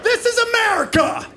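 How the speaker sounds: very high, strained mock scream; hammed-up scream. Shopper losing it over paper towels, high mock screaming. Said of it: "I started screaming like".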